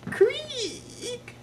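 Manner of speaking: high squeaky creaking door. A narrator's reading. Narrator imitating the office door creaking open. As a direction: squeak like an old hinge.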